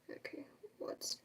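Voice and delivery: soft whisper to herself. Real, not acted. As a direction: whisper while reading a comment. Reading a comment under breath.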